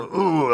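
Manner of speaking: high, drawn-out mock "ooh" of pain; imitated pained groan. Narrator mimicking Burns slumping at the verdict, mock pained groan. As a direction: groan as if kicked below the belt. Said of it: "like he'd been kicked in the nuts".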